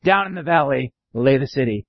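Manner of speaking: ordinary delivery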